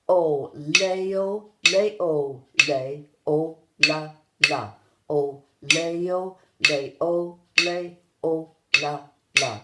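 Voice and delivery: loud, measured count-along chant; slow, in rhythm. Shown as a demonstration. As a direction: say each syllable slowly on the beat. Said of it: "it slowly, but in rhythm".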